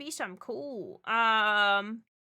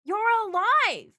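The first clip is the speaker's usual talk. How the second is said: high, loud joyful cry; thrilled to see them back; loud delighted exclamation; excited; 9 semitones higher, 9 dB louder than usual. Real, not acted. Streamer greets returning viewer.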